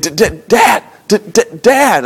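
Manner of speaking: put-on light childlike stammer on Dad; imitating son's stutter. Dad mimics his little son's stutter, lightly childlike. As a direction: stammer it like a small boy. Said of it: "but he stutters".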